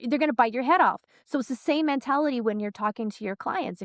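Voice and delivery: ordinary talk, nothing marked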